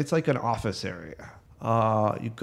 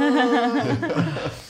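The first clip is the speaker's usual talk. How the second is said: squeaky snail laugh, pitched way up; high character laugh; 13 semitones higher, 4 dB louder than usual. Acted.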